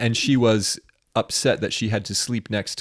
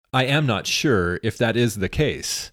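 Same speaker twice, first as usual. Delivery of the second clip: slow and deliberate, "I am" said as two full words; stilted, no contractions; slower than usual. Shown as a demonstration. Co-host correcting himself into the film's old-time style, stilted and slow. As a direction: give "I am not" formally, word by word. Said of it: "did that without contractions".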